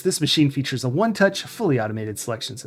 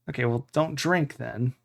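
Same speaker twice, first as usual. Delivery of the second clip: ordinary delivery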